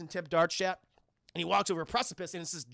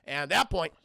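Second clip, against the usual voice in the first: ordinary delivery, nothing marked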